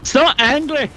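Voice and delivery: loud, high angry Scottish shout; angry Scotsman. Acted. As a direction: bellow it like a furious Scotsman. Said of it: "the angry Scotsman".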